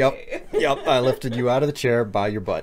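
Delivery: amused laughing admission. Real, not acted. Teasing about lifting her.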